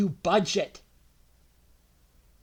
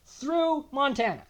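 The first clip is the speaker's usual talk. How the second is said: ordinary talk, nothing marked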